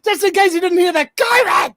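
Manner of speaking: very high, loud comic bellow; mock shouting. Repeating for those who didn't hear, high mock shout. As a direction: build to a blaring final word. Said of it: "it's in all capitals".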